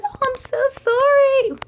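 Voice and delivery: high, slow, squeaky put-on apology; mock cashier apology. Cashier apologizing for the short change, high squeaky voice. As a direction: chirp it like a flustered cashier. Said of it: "She's like".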